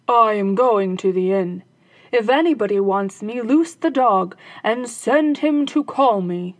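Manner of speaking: loud captain voice; robber captain. Acted. Captain telling his daughter to loose the dog, commanding. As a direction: give orders to his daughter.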